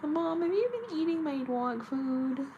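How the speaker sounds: high puppy voice; high excited pet talking; dog voice. Pretend. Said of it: "She'd be like".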